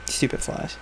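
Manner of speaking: whispered grumble under the breath; fed up, hiss it to yourself; muttered whisper. Real, not acted. Annoyed at the flies attacking him, whispering.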